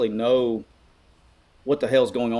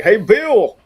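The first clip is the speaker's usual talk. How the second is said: higher, louder schoolyard taunt; mocking kids; 9 semitones higher, 9 dB louder than usual. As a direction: call it out like a teasing kid across the playground. Kids teasing him at school.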